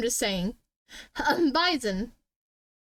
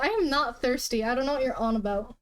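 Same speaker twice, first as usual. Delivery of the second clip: ordinary delivery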